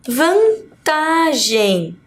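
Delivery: "vantagem" very slow, split after "van", long "ta"; stress on TA. Shown as a demonstration. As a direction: sound out "vantagem" slowly to show the "ta". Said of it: "tá, vantagem". ("vantagem" bem devagar, com o "ta" alongado)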